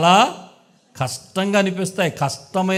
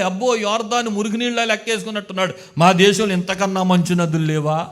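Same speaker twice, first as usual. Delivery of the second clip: ordinary delivery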